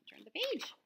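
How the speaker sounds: ordinary delivery, nothing marked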